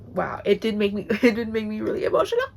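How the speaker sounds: laughing through tears, rushed; teary laugh. Real, not acted. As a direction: giggle while choked up. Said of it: "Let me catch my breath".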